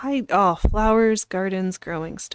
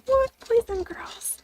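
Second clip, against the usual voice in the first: surprised whisper; 12 semitones higher, 4 dB quieter than usual. Real, not acted. Reacting to more gifts.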